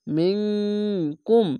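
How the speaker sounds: মিংকুম with the nasal hum held long and slow; ikhfa ghunna. Shown as a demonstration. Teacher showing Quran students ikhfa ghunna, long nasal hum. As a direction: draw out মিংকুম through the nose, slow and nasal. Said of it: "এখানে ইখফা গুন্না".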